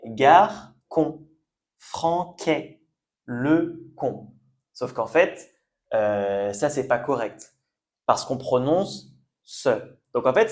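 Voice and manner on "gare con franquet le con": "Gare, con. Franquet. Le con." in separate syllables with K sounds; hard C misreading. Shown as a demonstration. Teacher showing the logical but incorrect reading, hard C. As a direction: read garçon, français, leçon syllable by syllable with K for ç. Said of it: "on devrait prononcer K".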